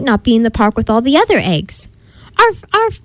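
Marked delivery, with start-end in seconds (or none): 2.4-3.0 high spoken dog barks; barking. A narrator's reading. Marley the dog barks as he takes off, high arfs. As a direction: yap "arf" high, like a storybook dog. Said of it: "barked Marley".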